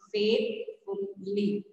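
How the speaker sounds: slow "Faithfully" broken as faith, ful, ly; syllable by syllable. Shown as a demonstration. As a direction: pause after each syllable of "Faithfully". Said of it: "you have three syllables".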